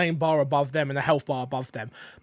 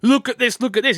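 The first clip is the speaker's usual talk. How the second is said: higher and louder, insistent; showy excited call-out; 6 semitones higher, 3 dB louder, faster than usual. Pretend. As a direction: point it out like an eager guide. Mock game pointing things out.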